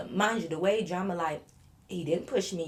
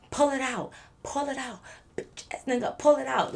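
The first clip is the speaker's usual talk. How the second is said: taunting yell; 5 semitones higher than usual. Retold or imitated. Drunk friend taunting again.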